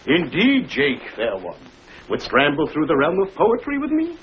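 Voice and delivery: hammed-up courtly speech; teasing in old-fashioned English; mock archaic. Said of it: "Stop the tomfoolering".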